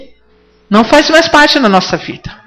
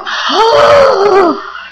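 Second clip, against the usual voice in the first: sharp high gasp of amazement; astonished gasp; 6 semitones higher than usual. Acted. Preacher acting out a dollar found, gasping. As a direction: gasp as if you just saw money.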